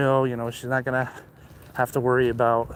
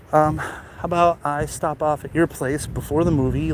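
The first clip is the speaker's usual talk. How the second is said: normal talking voice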